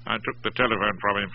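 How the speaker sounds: usual speaking voice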